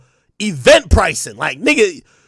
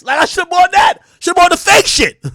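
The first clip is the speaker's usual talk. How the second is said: frustrated shout; 9 semitones higher than usual. Real, not acted. Streamer telling chat he should have bought fakes, frustrated shout. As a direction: yell it like you got ripped off. Regretting buying legit merch.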